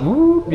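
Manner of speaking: high rising whoop; imitated whoop. Preacher shows how chills hit him, high whoop. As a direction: let out a high little whoop.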